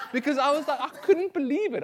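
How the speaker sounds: can't stop laughing as he tells it. Real, not acted. Laughing while retelling the story.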